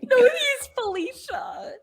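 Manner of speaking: very high, drawn-out mock voice; high, slow, giggly character quote; Kelvin impression. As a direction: imitate him in a squeaky high pitch, half laughing. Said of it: "When Kelvin's like".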